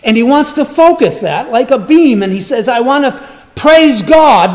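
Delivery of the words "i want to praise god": higher, louder eager vow; eager declaration. Retold or imitated. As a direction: proclaim it with eager zeal. Voicing David's declaration.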